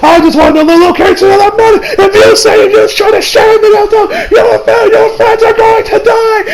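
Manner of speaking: high, strained villain shouting; over-the-top anime tirade; mock ranting. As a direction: escalate into a hammed-up hysterical threat. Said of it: "The same thing happens here".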